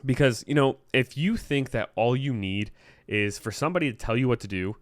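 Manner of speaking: ordinary delivery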